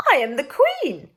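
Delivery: high, loud, disbelieving insistence; incredulous queen. Acted. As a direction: insist on her rank in disbelief. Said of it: "The lady was incredulous".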